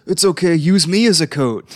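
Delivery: voicing the Naga. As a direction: lightly play the helpful snake. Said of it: "It's like".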